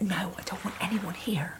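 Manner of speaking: voiceless tearful whisper, acted out; upset whisper. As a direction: whisper it as if hiding while crying. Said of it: "back off stage crying".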